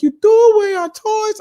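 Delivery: put-on high, loud child voice; squeaky outraged kid; upset kid. Son asking dad about thrown toys, high kid voice. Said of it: "And he was like".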